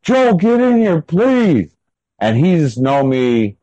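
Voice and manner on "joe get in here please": retold shout to nephew from the bed; desperate yell. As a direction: yell for him to come help, panicked. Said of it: "I'm yelling at him".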